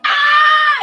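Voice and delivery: high, loud drawn-out "ah"; imitated loud scream. Dreamer imitates the terrifying scream from portal, loud scream. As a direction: belt out a long, high mimicked scream. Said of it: "the scream was so loud".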